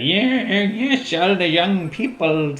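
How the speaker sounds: put-on high cracked old man voice. Shown as a demonstration. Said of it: "just have to crack their voice a lot".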